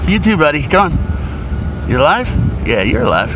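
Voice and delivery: high coaxing voice to a crab. Real, not acted. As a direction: sweet-talk a little critter. Coaxing crabs back to sea.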